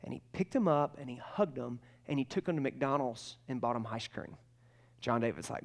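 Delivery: ordinary delivery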